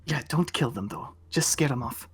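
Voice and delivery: hushed. Pretend. Riley whispering plan.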